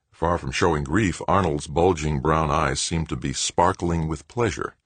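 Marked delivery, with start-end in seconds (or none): none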